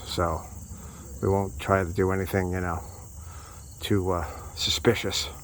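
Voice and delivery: dropping the voice low and soft; quiet, as if being watched; hushed. Real, not acted. Video surveillance, jokes quietly.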